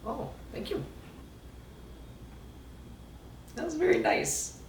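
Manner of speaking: squeaky thank-you voice; high put-on voice. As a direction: thank them in a tiny high voice.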